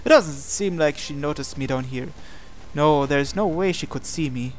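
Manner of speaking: ordinary talk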